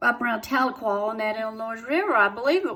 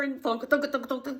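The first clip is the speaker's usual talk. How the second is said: usual speaking voice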